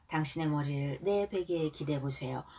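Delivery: ordinary talk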